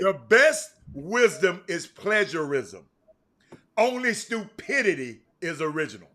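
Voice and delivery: slowed-down repeat. Shown as a demonstration. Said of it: "You said it too fast".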